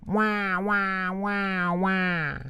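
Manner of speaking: put-on high baby-like wail; principal wailing like a baby. A narrator's reading. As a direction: grown man wails like a baby. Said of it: "principal looked at needle and said".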